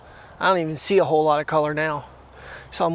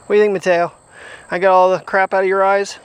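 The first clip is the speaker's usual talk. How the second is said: raised, fond calling to the dog; loud pet talk; 3 semitones higher, 7 dB louder than usual. Real, not acted. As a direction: call out to the dog brightly.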